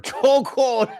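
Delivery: high laugh through the word. Real, not acted. Laughs at chat comment.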